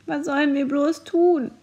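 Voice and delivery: put-on a frightened girl sobbing the question. Lotte, cowering in a corner, sobs to the others, mildly tearful. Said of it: "schluchzte Lotte".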